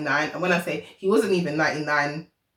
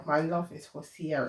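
sincere; 3 semitones lower, 10 dB quieter than usual. Real, not acted. Repeated line, soft and slow.

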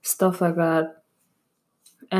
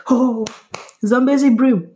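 excited gasp; 5 semitones higher, 4 dB louder than usual. Real, not acted. Gasps at remembered fish.

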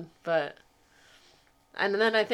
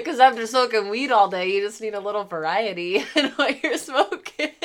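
talking through laughter; giggling while explaining; laughing; 4 semitones higher, 8 dB louder than usual. Real, not acted.

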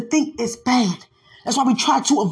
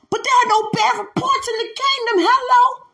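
preaching at full force; 10 semitones higher than usual. Acted. Preacher to the congregation, defying Satan, in full-force preaching. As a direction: shout it out over the music, taunting the devil.

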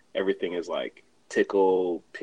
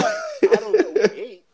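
laughing; 9 dB louder than usual. Real, not acted. Laughing defensive reply.

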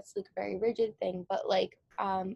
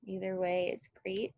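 guided meditation. Acted. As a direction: ease them into stillness with long pauses. Guided meditation begins, eyes closed.

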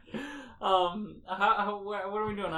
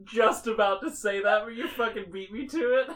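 loud; 6 semitones higher, 6 dB louder than usual. Real, not acted. Shouts, beaten to joke.

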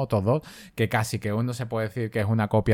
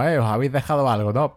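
quoted a little higher and louder; louder retold quip; 4 semitones higher, 6 dB louder than usual. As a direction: throw the line out as a cocky action-hero quip. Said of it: "y le dice otra vez lo mismo".

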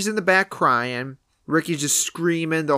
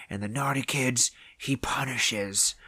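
creepy grandpa; 3 semitones lower, 7 dB quieter than usual. Retold or imitated. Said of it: "it's just being so creepy".

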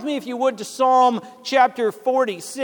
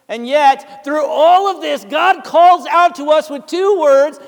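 louder, higher proclamation; rising preaching; 4 semitones higher, 7 dB louder than usual. Real, not acted. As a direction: lift your voice as the point lands. Preacher building to a point.

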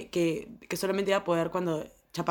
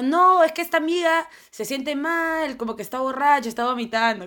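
acted excuse; 6 semitones higher, 3 dB louder than usual. Girl covering for herself to friends outside the bathroom, acted innocent excuse. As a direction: plead it high and stretched, playing innocent.

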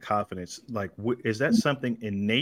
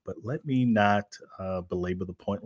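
usual speaking voice

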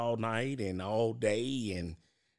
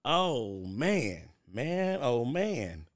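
ordinary delivery

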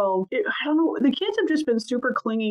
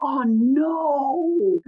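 put-on mortified kid; 4 semitones higher than usual. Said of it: "She went".

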